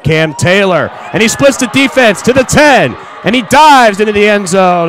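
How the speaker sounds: high, shouted touchdown call. Acted. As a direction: yell the touchdown home. Touchdown run called live.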